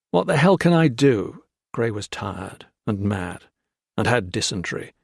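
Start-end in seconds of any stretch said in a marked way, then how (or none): 0.1-1.4 high, loud exasperated retort. Acted. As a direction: snap back in frustration. Gray exasperated.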